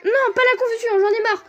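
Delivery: high exasperated outburst; raging gamer. Real, not acted. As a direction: rage at the confusion. Pokémon gets confused, player rages.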